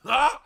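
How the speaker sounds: high, loud frustrated "ah" yell; exasperated yell. Real, not acted. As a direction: cry out in exasperation. Exasperated cry at viewer.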